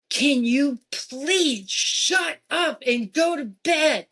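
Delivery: put-on fed-up mother snapping at the boys; mad mom yelling. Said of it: "I'm mad".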